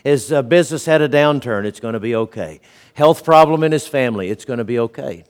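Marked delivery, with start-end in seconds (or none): 1.6-2.6 calm reassurance. Retold or imitated. Pastor voicing his friend's calm refrain, softly reassuring. 4.3-5.2 softer, settled calm; calm reassurance. Retold or imitated. Pastor voicing his friend's calm refrain, softly reassuring.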